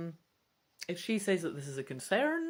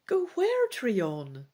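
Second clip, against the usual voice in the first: higher, louder startled question; surprised question; 4 semitones higher, 6 dB louder, much slower than usual. Acted. Sister halting the dragon, surprised question. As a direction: catch him short, surprised.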